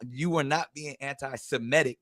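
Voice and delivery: ordinary talk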